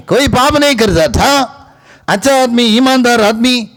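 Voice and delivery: high-pitched, insistent preaching; high, ringing exhortation; preaching at full force. Real, not acted.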